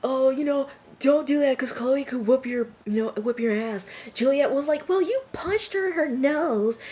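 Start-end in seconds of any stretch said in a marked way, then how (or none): 0.0-3.9 high, lightly acted squabbling; mock yelling. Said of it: "they're yelling". 4.9-6.8 high-pitched mock protest; mock yelling. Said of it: "they're yelling".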